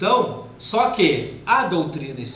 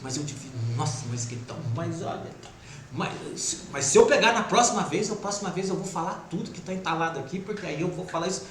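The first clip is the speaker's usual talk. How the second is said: brooding inner voice; 3 semitones lower, 8 dB quieter, faster than usual. Retold or imitated. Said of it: "você fica tempo ali, ó, com aquilo".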